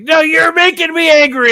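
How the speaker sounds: high, loud outburst of put-on anger; hammed-up angry retort; mock anger. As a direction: act out getting angry, voice jumping way up. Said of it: "getting angry".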